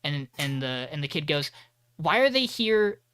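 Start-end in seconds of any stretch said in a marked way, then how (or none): 2.0-3.0 put-on curious kid question.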